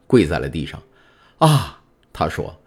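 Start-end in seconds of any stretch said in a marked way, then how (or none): 1.4-1.8 sudden high gasp of shock, louder; startled cry. A narrator's reading. Prisoner startled by a voice. (惊愕地叫出声)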